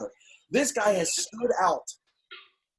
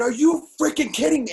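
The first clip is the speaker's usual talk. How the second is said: high, loud exasperated shout; retold blowup; 9 semitones higher, 6 dB louder than usual. Blowing up at the clerk, loud and high. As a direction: let it explode at the desk. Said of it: "I, I blew up".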